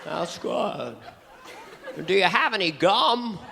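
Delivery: high, drawn-out folksy character voice; halting and mild, swooping up on key words; Mister Stewart. Acted. Awkward man to his date, high halting drawl. Comic plays Stewart figure, named later.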